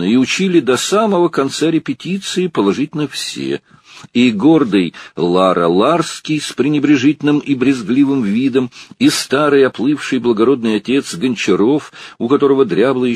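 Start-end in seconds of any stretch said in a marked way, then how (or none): none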